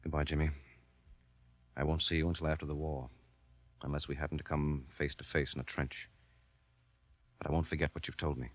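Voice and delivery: low, quiet and heavy; grave goodbye to a friend; somber farewell. Acted.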